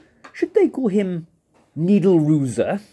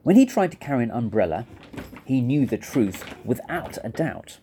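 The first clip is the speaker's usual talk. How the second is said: usual speaking voice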